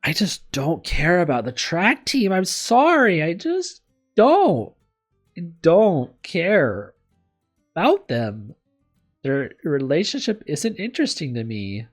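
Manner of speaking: loud, high, slow emphatic complaint; exasperated rant. Real, not acted. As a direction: stretch each word in pure exasperation. Rant about disliked couple.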